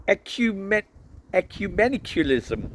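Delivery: high Welsh priest voice; halting try at a Welsh accent. A narrator's reading. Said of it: "trying to do a Welsh accent".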